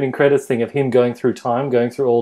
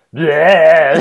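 mock shout; 5 dB louder than usual. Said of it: "he's like".

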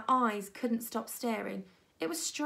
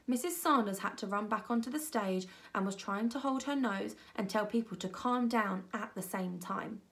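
ordinary delivery, nothing marked

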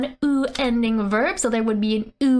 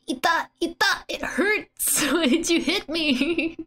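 put-on high, pained manga-girl whine; mock agony; 6 semitones higher than usual. She asks why she was hit, mock agony. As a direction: whine in play agony, pitched well up. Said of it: "in utter agony".

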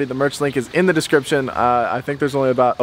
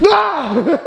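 high scream breaking into laughter; jump-scare yell, then laugh; startling scream; 14 semitones higher than usual. Real, not acted. Jumping out to scare friends.

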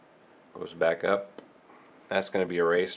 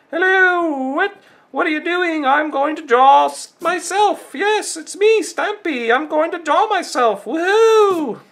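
loud, very high squealing cartoon voice; Stampy Longhead; 10 dB louder than usual. Announcing a self-portrait to viewers, very high excited impression. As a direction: put on a piercing, giddy falsetto and end on a whoop. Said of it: "does it sound like Stampy?".

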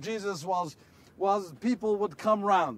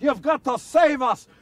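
louder, higher pleading cry; desperate plea; 5 semitones higher, 7 dB louder than usual. Acted. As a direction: beg to be saved from the storm. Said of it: "We said".

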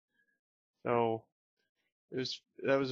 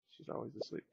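whispering; 13 dB quieter, much faster than usual. Real, not acted. Whispered aside.

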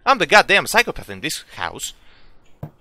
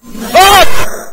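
short high loud shriek of fright; real jump-scare yelp; startled scream; 13 semitones higher, 15 dB louder than usual. Real, not acted. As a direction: scream as something jumps out.

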